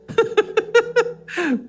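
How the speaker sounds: high burst of laughter; hearty laugh. Real, not acted. Pastor laughing that wives hit hard, high and hearty.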